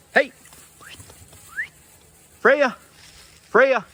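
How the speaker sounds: high, loud call across the yard; loud high-pitched calling. Real, not acted. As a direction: shout the dog's name up high. Calling the puppy by name.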